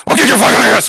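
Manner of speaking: sudden yelled threat, high and loud; imitating an angry yell; mock threat. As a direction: blow up into a shout. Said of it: "He's like".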